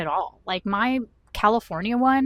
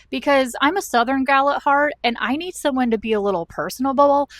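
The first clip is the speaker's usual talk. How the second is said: heated rant; 5 semitones higher, 6 dB louder than usual. Real, not acted. Ranting about needing friendliness.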